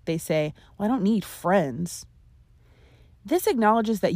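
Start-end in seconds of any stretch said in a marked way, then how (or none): 0.8-2.1 "friends" leaned on at the end; stress on FRIENDS. Shown as a demonstration. Voicing those who stress the last word, stress on "friends". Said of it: "those who stress the last word".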